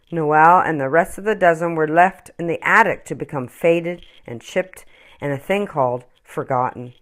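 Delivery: usual speaking voice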